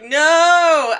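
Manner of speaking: loud, high, drawn-out mock wail; outraged cry. Hot Rod answering Double Face's taunt, outraged cry. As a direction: cry it out in dramatic protest.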